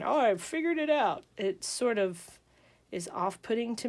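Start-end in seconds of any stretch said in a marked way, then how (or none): 0.0-1.2 higher, self-mocking announcement; lightly put-on, pleased-with-myself voice; mock know-it-all. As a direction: imitate a tutor claiming to have the answer. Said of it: "me standing here going".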